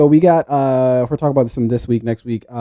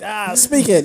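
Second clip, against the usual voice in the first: mock American; 9 semitones higher, slower than usual. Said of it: "and we're like".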